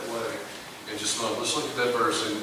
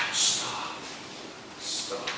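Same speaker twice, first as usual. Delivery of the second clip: hushed stop; 7 dB quieter than usual. Acted. Preacher halts congregation.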